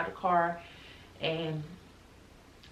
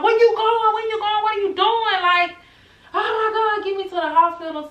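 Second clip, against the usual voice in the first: high, loud desperate cries; frantic in pain, shouting at the driver; panicked yelling; 9 dB louder than usual. Retold or imitated. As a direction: shriek in disbelief then plead. Panicked at wrong turn.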